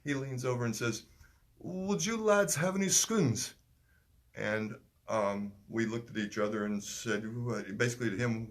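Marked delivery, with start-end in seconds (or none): 1.6-3.5 put-on slow, high English accent, "scones" for the word. Stranger on the balcony asking the lads, in a high English accent. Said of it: "I don't like to do the English accent".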